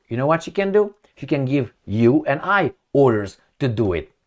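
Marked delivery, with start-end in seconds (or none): none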